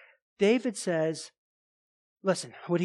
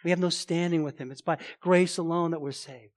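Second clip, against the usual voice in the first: ordinary talk, nothing marked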